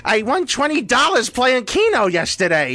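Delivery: put-on high, comic elderly voice; hospital old-timer. Gowned patient chatting to everyone, put-on old voice. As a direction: brag like a doddery hospital patient. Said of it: "you just feel old".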